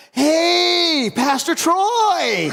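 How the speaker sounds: high, loud, stretched-out friendly hello; a parishioner spotting his pastor, beaming; surprised cheerful greeting. Retold or imitated. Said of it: "he goes".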